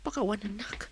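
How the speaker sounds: an ashamed hushed whisper; whispered self-reproach. Acted. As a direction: chide yourself under your breath. Wife scolds herself, hushed.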